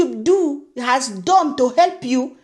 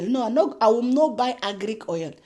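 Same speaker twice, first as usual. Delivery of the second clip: ordinary delivery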